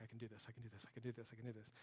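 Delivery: softly repeated nervous reassurance; hushed self-coaching. Retold or imitated. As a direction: talk yourself through rising panic under your breath. Panicking in cave, self-talk.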